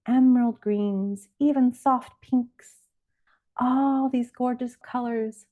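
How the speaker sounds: usual speaking voice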